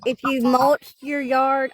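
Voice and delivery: slow, repeated without dentures; lisping gums without teeth; toothless. Real, not acted. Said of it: "I don't have my teeth in".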